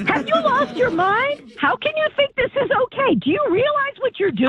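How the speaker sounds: high-pitched real indignation; real exasperated outburst. Real, not acted. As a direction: protest in disbelief, voice pushed up high. Mother berating her son's girlfriend.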